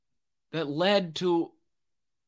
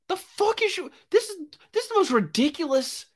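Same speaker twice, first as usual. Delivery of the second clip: much higher, fast, disbelieving squeal; incredulous outburst; faster than usual. Real, not acted. Vlogger reacting to the writer's plea with shocked disbelief. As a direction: shriek at how absurd it is.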